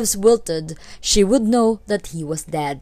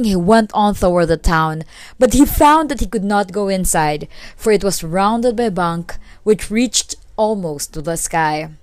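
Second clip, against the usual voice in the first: plain reading voice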